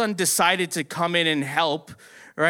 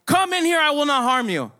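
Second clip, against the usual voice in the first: high, re-enacted call to come in; shouted invitation; 8 semitones higher than usual. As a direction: call out insistently, pitched up. Calls the worker into the room.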